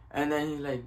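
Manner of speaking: usual speaking voice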